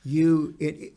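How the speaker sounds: ordinary talk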